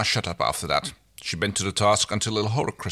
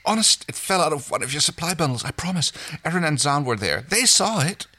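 high, anxious-sounding protest; mildly panicked self-defence; pleading excuse; 7 semitones higher than usual. A narrator's reading. Frantic excuse to angry crewmate.

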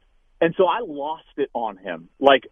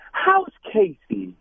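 slow, lightly raised sugary mimic of wife; soft and sweet; 7 semitones higher, much slower than usual. Wife interrupting his angry rant, slow soft sweet question. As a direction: ask it as sweetly as you can to tease. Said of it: "as soft and sweet as she possibly can".

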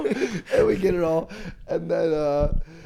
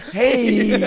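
loud, high comic greeting; over-loud hello through laughter; Will Ferrell; 10 semitones higher, 11 dB louder than usual. Retold or imitated. Host greeting visitors after the outburst, loud forced cheer. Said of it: "Like Will Ferrell".

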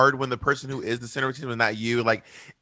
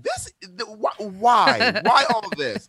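higher-pitched, stammering disbelief; baffled and put out, pitched up; mock exasperation; 7 semitones higher, slower than usual. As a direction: sputter into an appalled "why". Acted reaction to louder person.

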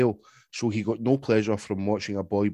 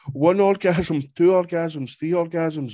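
mock excited counting; 6 semitones higher, 4 dB louder, slower than usual. Reading out a climax count.

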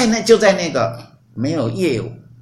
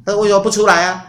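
louder and higher, pressing the question; demanding question; 8 semitones higher, 8 dB louder, faster than usual. Acted. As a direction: call them out of hiding. God questions the hiding couple. (上帝追问躲起来的亚当夏娃，提高声音质问)

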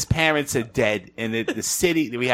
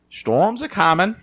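slow, higher Maine drawl; Maine accent; 7 semitones higher, slower than usual. Pretend. Imitating a Maine local for friends, slow Maine accent. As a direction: warn of the storm like an old Mainer. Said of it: "I'm trying to think of what it sounds like".

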